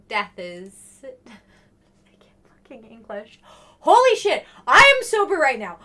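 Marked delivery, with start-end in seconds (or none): 1.0-3.4 whisper. Real, not acted. Mutters at own mistake. 3.8-5.8 exclaiming. Real, not acted. Exclaims at own mistake.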